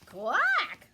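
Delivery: loud, high squawking quack; duck quack. Acted. Storyteller voicing the duck loudly for children. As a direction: blast out a duck's quack. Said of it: "said the duck".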